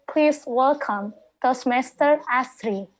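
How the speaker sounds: ordinary talk, nothing marked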